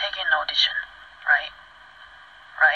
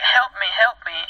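mild mock cry for help; slightly raised, higher voice, imitating a plea; imitated scream for help; 4 semitones higher than usual. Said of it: "the screaming".

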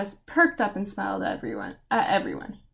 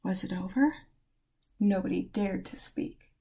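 softer, lower hush; hushed suspense; 3 semitones lower, 7 dB quieter than usual. A narrator's reading. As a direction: hold your breath as you say it. Tense hush after chaos.

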